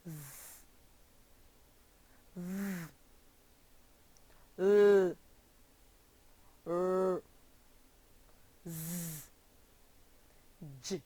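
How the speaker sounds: isolated consonant sounds, not letter names, with pauses; voiced sounds. Shown as a demonstration. Teacher has learners repeat voiced sounds, isolated and held apart. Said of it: "Repeat after me".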